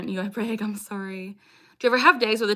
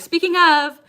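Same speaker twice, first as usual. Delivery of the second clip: excited; 6 semitones higher, 7 dB louder than usual. Real, not acted. Upbeat subscribe plea.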